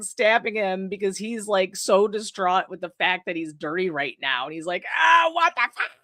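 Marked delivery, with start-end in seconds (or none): 5.0-5.9 high, yelped mock panic; freaking out. Killer being stabbed by Margaret, high panicked yelp. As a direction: yelp it out high, startled and hurt. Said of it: "he was just freaking out".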